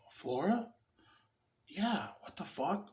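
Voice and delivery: softly voiced, mild disbelief; soft dawning realization. Man hears his fiancee is outside, softly stunned. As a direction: say it softly, as if it just came back.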